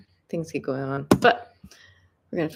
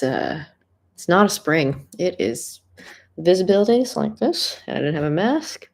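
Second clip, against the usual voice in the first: ordinary talk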